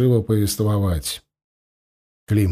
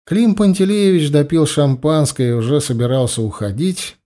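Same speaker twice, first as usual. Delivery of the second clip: ordinary delivery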